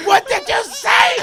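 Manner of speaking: slow, high-pitched furious shout; enraged yell. Retold or imitated. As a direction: scream the challenge like a kid gone berserk. Kid enraged, shouting in story.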